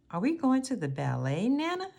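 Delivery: quick and eager, rising high on Nana; bubbly eager question to grandma; excited child question. A narrator's reading. Grace asks Nana about the ballet, eager and rising. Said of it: "asked Grace".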